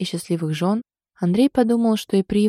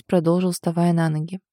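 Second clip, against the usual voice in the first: usual speaking voice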